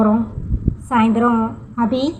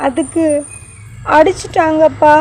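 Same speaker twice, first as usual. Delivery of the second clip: put-on higher, halting small boy's voice; boy telling his father about his friend; child's baby talk; 5 semitones higher than usual. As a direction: talk like a little kid telling dad a secret. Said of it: "Ashwin தன்னுடைய மழலை மொழியில்".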